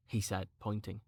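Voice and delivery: ordinary delivery, nothing marked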